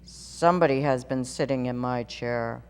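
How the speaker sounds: great huge bear voice. A narrator's reading. Papa bear voiced in story.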